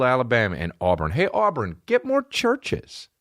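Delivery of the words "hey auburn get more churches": mock call-out. Calling out to the town.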